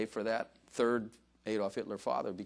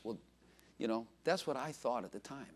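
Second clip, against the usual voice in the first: normal talking voice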